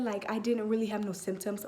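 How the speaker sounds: ordinary delivery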